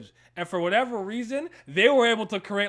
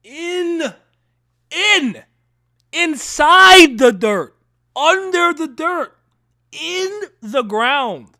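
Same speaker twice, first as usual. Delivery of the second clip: loud, slow, much higher hyped shout; 9 semitones higher, 12 dB louder, much slower than usual. Real, not acted. Host hyping up Gurren Lagann, loud slow shout. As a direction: drag out each phrase and yell it.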